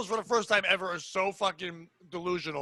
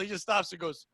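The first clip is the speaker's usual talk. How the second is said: ordinary talk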